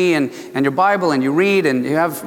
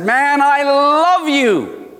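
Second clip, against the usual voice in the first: high, drawn-out proclamation, stretching LOVE; God's exuberant message; 10 semitones higher, 3 dB louder, much slower than usual. Retold or imitated. Preacher voices God's message to man, exuberant proclamation. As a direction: belt out love slowly, pitched way up. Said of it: "finally God spoke a message".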